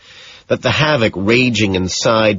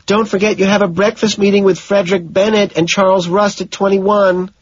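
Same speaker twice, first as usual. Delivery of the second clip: higher voiced woman calling across; 11 semitones higher than usual. A narrator's reading. Jean calling from the door to Patrick, higher voiced. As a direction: call it out from the doorway.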